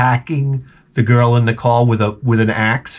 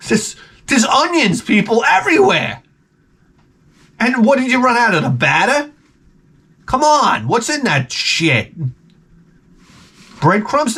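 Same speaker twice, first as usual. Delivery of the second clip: incredulous rant; 8 semitones higher than usual. Real, not acted. Ranting about onion rings.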